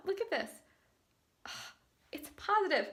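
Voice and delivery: quiet, stunned, breathless disbelief; barely above a whisper, overwhelmed; soft trembling excitement; hushed shock. Real, not acted. Recorded clip right after test.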